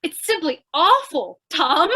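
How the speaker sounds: ordinary talk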